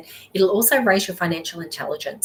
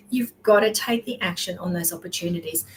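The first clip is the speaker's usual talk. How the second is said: ordinary talk, nothing marked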